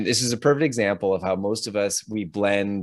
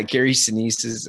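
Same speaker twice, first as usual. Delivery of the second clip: ordinary delivery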